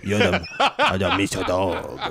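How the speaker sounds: high goofy comedian voice, half laughing; Adam Sandler voice. Acted. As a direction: goof around in a silly voice. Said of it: "It's just Adam Sandler".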